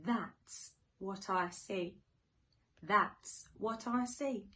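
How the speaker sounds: sing-song storybook rhyme; reading to children. Acted. Teacher reading to little ones, sing-song and playful. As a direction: read it like a bedtime picture book. Reads rhyming picture book.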